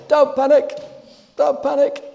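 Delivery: high, panicky catchphrase impression; Corporal Jones. Preacher quoting a sitcom catchphrase to the church, mock panic. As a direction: cry it out loud and high, in a mock flap. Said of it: "Corporal Jones was well known for his catchphrase".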